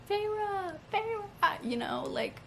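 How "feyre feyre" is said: higher, calling her name twice; mocking a man calling Feyre; imitating Rhysand calling. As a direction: call out her name urgently, lightly voiced. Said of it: "the one who was like".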